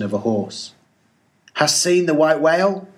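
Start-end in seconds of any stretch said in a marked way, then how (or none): 1.5-2.9 raised, higher hail across the water; hailing call. Acted. Ahab hails the stranger's captain across the sea, raised call. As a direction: call out to the other ship.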